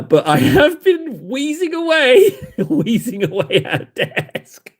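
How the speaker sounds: laughing while wheezing. Real, not acted. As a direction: giggle through the words. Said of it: "out of breath".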